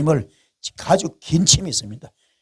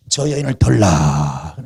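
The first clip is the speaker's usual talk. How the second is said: king's command; 10 semitones lower, 3 dB louder, slower than usual. Retold or imitated. David orders the woman brought.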